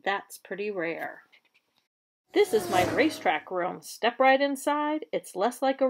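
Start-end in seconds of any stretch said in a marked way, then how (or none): none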